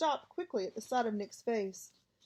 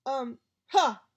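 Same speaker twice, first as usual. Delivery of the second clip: loud scoffing yell; 2 semitones higher, 8 dB louder than usual. A narrator's reading. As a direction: bark out a mocking laugh.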